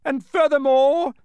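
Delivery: high, loud herald's declaration; formal proclamation. A narrator's reading. Cabinet continues the proclamation from the scroll, loud declaiming. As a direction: announce it to the whole crowd. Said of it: "continued the proclamation".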